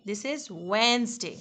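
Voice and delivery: slow "Wednesday" as WENZ-day, silent d; slow WENZ-day, no d sound; correct Wednesday. Shown as a demonstration. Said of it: "this is not Wednesday".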